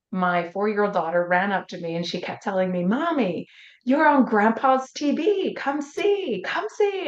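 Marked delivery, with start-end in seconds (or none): 2.8-7.1 put-on high, eager little girl calling mommy; daughter's excited plea. Daughter tugging at mom, high excited little-girl voice. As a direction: pitch up like a four-year-old tugging her mom.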